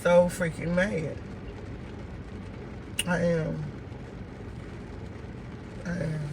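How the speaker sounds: low, soft, dejected venting; upset. Real, not acted. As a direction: say it quietly, voice sunk low, worn down by it all. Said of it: "I'm upset. I am very upset".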